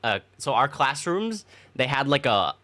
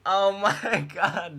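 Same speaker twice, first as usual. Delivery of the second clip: laughing; 5 semitones higher, much slower than usual. Real, not acted. Laughing at the memory.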